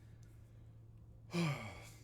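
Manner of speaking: soft unvoiced tired sigh; weary sigh. Real, not acted. Vlogger tired at night before melatonin, weary sigh. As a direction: breathe out, worn down.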